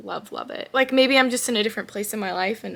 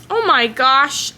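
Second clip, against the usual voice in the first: loud, slightly higher drawn-out exclamation; startled exclamation; 4 semitones higher, 10 dB louder, slower than usual. Real, not acted. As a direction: break off with a loud, slow burst of surprise. Startled by the air conditioning.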